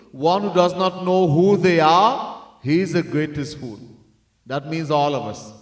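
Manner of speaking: usual speaking voice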